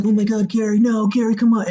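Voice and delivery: mock pleading over dying Gary. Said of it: "they're whimpering".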